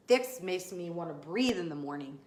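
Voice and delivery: normal talking voice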